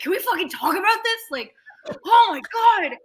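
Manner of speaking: high, loud retold panic; freaked out. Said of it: "I freaked out".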